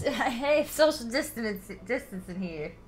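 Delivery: a hint of a New York tough guy; Brooklyn accent. Continuing the Brooklyn impression.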